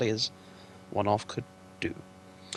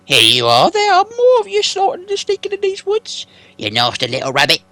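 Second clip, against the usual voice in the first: very high, loud sly troll voice; troll Bert; 13 dB louder than usual. Acted. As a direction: croon the idea like a greedy troll.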